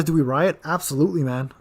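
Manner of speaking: usual speaking voice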